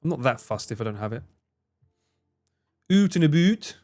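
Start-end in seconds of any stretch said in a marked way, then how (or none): 2.9-3.8 hammed-up Canadian accent, higher; a jokey Canadian accent; mock Canadian accent. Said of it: "what was supposed to be a Canadian accent".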